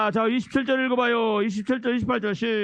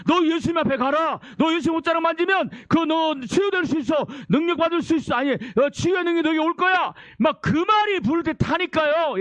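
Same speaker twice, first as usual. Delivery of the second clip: insistent, raised sermon voice; impassioned preaching; 6 semitones higher than usual. Acted. Preacher urging the sick to go to Jesus, impassioned preaching. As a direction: drive each promise home.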